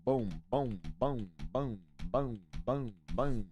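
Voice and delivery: usual speaking voice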